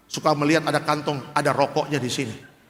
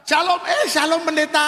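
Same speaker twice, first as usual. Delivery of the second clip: hammed-up startled greeting; 12 semitones higher than usual. Smoker caught by the pastor, high startled greeting. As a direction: greet the pastor like you've just been caught.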